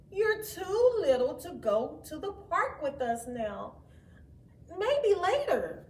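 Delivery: lightly voiced older brother, brushing her off; big brother voice. A narrator's reading. Matt telling his sister she's too small, mildly dismissive. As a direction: talk down to the little one. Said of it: "said Matt".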